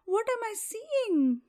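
high, loud panicked stammer; terrified shriek. Acted. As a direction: shriek it out in terror. Prince sees bear.

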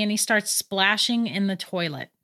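ordinary delivery

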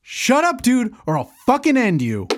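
high, shouted threat; furious threat. Acted. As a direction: snarl a threat. Threatens friend.

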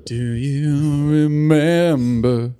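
drawn-out mock echo; very slow echo. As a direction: stretch each word out.

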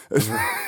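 squeaky burst of giggling; a quick high laugh; high giggle. Real, not acted. Laughs at own joke.

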